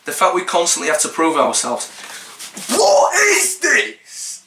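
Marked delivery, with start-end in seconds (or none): 2.7-4.4 slow, high, wailing What is this?; outraged cry. Real, not acted. Vlogger cries out at an absurd article item, outraged wail. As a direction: drag it out in outraged disbelief.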